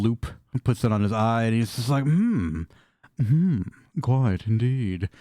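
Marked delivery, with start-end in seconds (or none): 2.0-5.1 snooty appraiser. Acted. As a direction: peer down your nose and drawl it out. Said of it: "Why'd you sound different, mate?".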